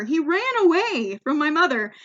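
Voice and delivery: normal talking voice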